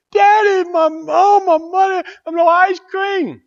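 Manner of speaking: put-on high, loud wailing little girl, slow at the end; boo-hooing child. Little girl wails to daddy after losing five dollars, high and loud. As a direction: wail like a child who lost her ice cream money. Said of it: "she starts boo-hooing".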